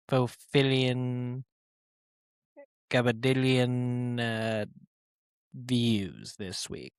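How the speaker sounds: a loose Doctor Evil, drawing out the silly big numbers; Doctor Evil impression. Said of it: "Doctor Evil setting up his podcast".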